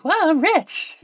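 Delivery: high, eager secretary voice; Peggy Olson. Retold or imitated. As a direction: gush thanks to the boss. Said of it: "she's like".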